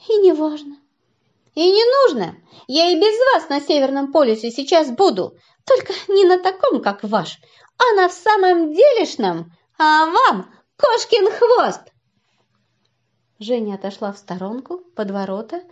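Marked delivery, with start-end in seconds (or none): 0.1-11.8 put-on huffy girl. Offended girl retorts.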